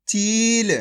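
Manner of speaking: चील with a long, held ee; slow teaching चील; drawn-out long vowel. Shown as a demonstration. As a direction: stretch the ee in चील before a light final l. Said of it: "खींच के".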